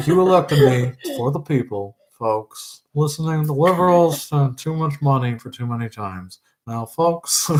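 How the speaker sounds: hammed-up politician addressing the folks; Doug Ford impression. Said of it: "your Ford nations".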